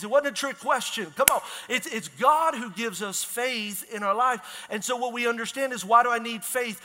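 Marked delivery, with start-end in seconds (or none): none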